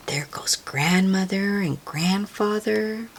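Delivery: soft whisper. Real, not acted. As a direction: whisper as if not to disturb them. Hushed aside on the family.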